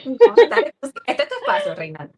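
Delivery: loud, bright encouragement; eager urging. Real, not acted. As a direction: push her to go ahead, enthusiastically. Urging guest to speak.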